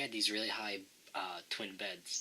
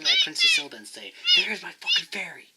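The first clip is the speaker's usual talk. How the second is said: high, loud shrieked punchline; mock furious yell; 14 dB louder than usual. Yelling at Princess Zelda about the fairy, mock fury. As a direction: scream the punchline.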